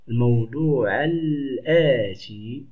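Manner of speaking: الموضوع الآتي slowly joined, long L and long aa; linked article. Shown as a demonstration. Teacher demonstrating the silent article hamza, الموضوع الآتي slowly linked. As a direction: draw out الموضوع الآتي with no break between the words. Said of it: "لا أنطق هذه".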